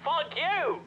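high-pitched burst of frustration; exasperated curse. Acted. Cursing while the cartridge search fails.

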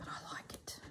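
barely audible whispered approval. Real, not acted. Crafter pleased with thick fabric, in a faint whisper. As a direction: whisper it like a secret. Quiet verdict to herself.

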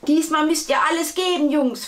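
high, loud, fast pep talk; rallying coach. A narrator's reading. Football coach to his boys before the final, rousing announcement. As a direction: fire up the team like a coach.

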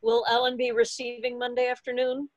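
ordinary talk, nothing marked

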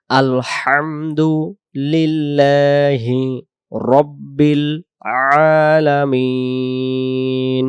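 Alamin drawn out, very slow; slow recitation. Shown as a demonstration. Teacher ending the verse in slow recitation. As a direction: stretch Alamin long like a chant.

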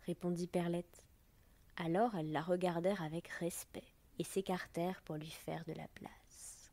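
plain reading voice

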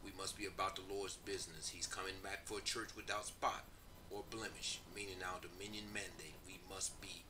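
subdued reading with little pitch movement; prepared introduction read aloud. Real, not acted. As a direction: read it quietly and evenly, like a recorded testimony.

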